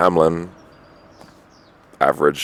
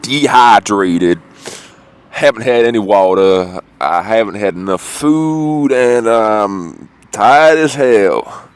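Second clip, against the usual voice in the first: high, slow, louder put-on voice; delirious; 6 semitones higher, 6 dB louder, slower than usual. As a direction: drag it out like you're half delirious. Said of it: "I'm kinda delirious".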